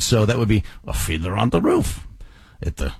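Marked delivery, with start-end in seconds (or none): 0.9-2.1 Tevye's Yiddish-tinged accent, rising at the end; Fiddler on the Roof accent. Shown as a demonstration. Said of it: "that would give me the accent". 2.6-2.9 low Yiddish-tinged Tevye accent; old-country Yiddish accent, pitched down; Fiddler on the Roof accent. Shown as a demonstration. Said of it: "that would give me the accent".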